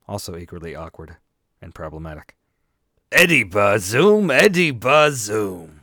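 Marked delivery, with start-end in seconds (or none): 3.1-4.3 loud, slow Pacino-style name call; Al Pacino impression. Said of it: "I can't go full Pacino". 4.3-5.8 even slower, drawn-out Pacino bellow; Al Pacino impression. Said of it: "I can't go full Pacino".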